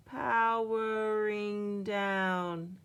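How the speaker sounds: very slow, winding-down robot voice; very slow fading machine voice; robot powering down. A narrator's reading. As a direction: drag it out like a dying battery. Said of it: "says Daddy Robot".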